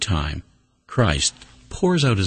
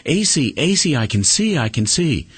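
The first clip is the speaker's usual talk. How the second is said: high, excited cry of a woman who can suddenly see; retold joyful cry; 9 semitones higher than usual. Preacher voicing a blind wife crying out, high and excited. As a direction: call out his name in sudden joy. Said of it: "he heard Marilyn cry out".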